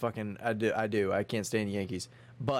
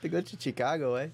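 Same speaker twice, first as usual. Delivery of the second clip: mock Mexican accent; 4 semitones higher than usual. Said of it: "Mexican accent".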